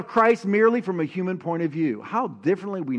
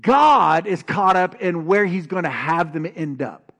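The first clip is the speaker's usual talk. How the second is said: ordinary delivery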